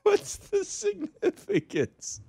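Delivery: very high, slow drawn-out question; mock dramatic. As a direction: stretch it out, high.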